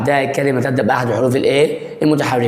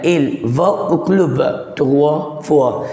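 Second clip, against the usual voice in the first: il va au club trois fois said word by word, very slowly; teacher dictates the sentence at half speed; slow model reading; much slower than usual. Shown as a demonstration. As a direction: spell the sentence out slowly for learners. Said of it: "slow model reading".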